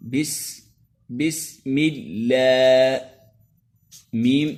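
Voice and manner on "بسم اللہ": اللہ with a thin light lam after the kasra, drawn out; thin lam in Allah. Shown as a demonstration. Teacher demonstrating thin lam in بسم اللہ. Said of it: "باریک پڑھتے ہیں".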